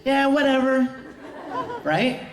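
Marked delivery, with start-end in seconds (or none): 0.0-0.9 higher-pitched offhand reply; lightly voiced boy's brush-off. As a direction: toss it off in a raised voice. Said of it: "And, and Ed goes".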